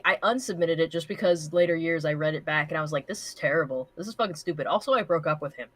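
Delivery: normal talking voice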